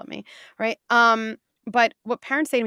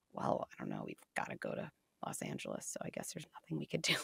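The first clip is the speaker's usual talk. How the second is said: low, soft, lazy pilot shrug; mock defeated pilot; 5 semitones lower, 15 dB quieter than usual. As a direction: play a hopeless pilot mumbling.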